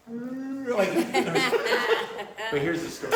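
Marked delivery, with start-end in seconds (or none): 0.1-2.5 high, laughing elephant trumpet; circus elephant impression. Comic acting out a friend's circus elephant impression, laughing. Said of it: "an impression of a circus elephant".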